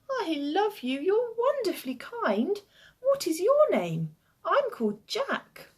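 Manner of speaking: put-on high, sweet child voice full of affection; adoring little boy.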